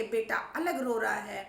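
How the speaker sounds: ordinary delivery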